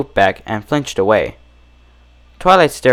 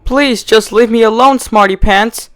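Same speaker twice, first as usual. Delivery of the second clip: high, pleading sob; desperate begging; 11 semitones higher, 3 dB louder than usual. Acted. Trapped Twilight begging her captor. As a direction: beg through tears. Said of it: "Twilight begged".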